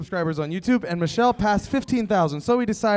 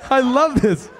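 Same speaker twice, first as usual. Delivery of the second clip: higher, shouted delight; excited shout; 7 semitones higher, slower than usual. Real, not acted. Host on stage shouting his delight to the crowd. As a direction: yell your joy to the room.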